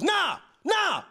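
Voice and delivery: high-pitched, emphatic high schooler's outburst. Retold or imitated. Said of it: "he just goes".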